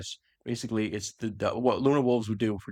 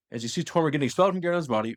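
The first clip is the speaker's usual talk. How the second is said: usual speaking voice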